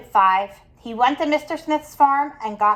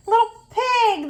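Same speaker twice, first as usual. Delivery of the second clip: higher, coaxing wolf voice luring the pig; sly sweet wolf; 11 semitones higher than usual. Acted. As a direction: lure him in with a sugary lilt.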